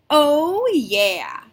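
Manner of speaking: loud, high cheer of Oh yeah; excited "oh yeah". Shown as a demonstration. Teacher cheering oh yeah to excite the class. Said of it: "Give me a big".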